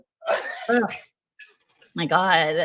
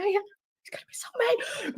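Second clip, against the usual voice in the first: slow, much softer, breathless whisper through giggles; whispered laughing; 5 semitones higher, 8 dB quieter, slower than usual. Real, not acted. A wife giggling quietly so her sleeping husband won't hear. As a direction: whisper it while trying not to laugh.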